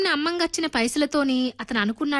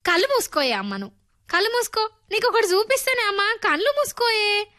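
a lightly voiced excited boy, higher; eager boy; 7 semitones higher than usual. A narrator's reading. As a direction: coax mother to shut her eyes, bursting with a surprise. Boy eagerly urging mother. (ఉత్సాహంగా అమ్మను బతిమాలే పిల్లాడు, కొంచెం ఎత్తైన గొంతు)

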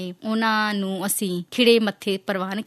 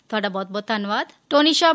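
normal talking voice

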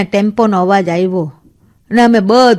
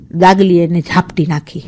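usual speaking voice

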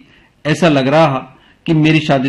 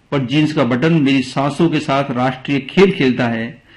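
usual speaking voice

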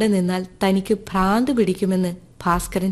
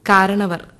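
ordinary delivery, nothing marked

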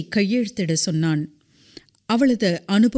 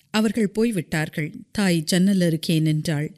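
ordinary delivery, nothing marked

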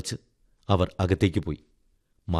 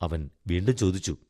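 plain reading voice

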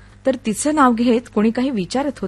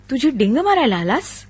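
sharp; 2 semitones higher than usual. A narrator's reading. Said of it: "तिनं तीक्ष्णपणे विचारलं".

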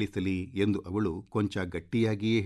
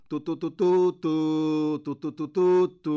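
high, loud vocal bugle fanfare; imitated trumpet call; 8 semitones higher, 6 dB louder than usual. A narrator's reading. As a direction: toot it like a herald's trumpet, long final notes. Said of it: "ತುತ್ತೂರಿಯನ್ನು ಬಾಯಿಗೆ ಹಿಡಿದು".

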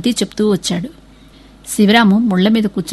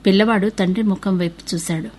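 ordinary delivery, nothing marked

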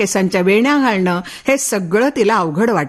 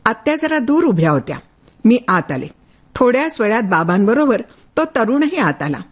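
ordinary delivery

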